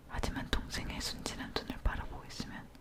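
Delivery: ordinary delivery, nothing marked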